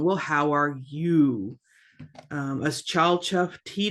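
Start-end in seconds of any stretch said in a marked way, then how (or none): 0.0-1.6 "you" stretched and emphasized at the end of "how are you"; stress on YOU. Shown as a demonstration. Teacher explaining the emphatic word, stress on you. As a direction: put the weight on "you". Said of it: "That's like an emphasis on".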